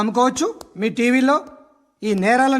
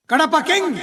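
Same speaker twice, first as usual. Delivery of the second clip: higher-pitched announcer's title call; mock film promo; 5 semitones higher, slower than usual. Officer ridiculing violent film hits, mock film promo. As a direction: hype the title mockingly.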